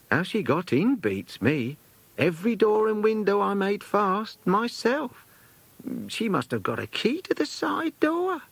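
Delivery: a hint of a bustling old companion at the door; chatty companion. Companion explains the break-ins.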